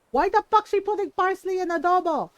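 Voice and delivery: imitating mom, yelled high and loud; mock outraged mother, high and loud; mom's outraged yell. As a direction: shout it like a mother offended by parsley in adobo. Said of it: "and my mom lost her shit".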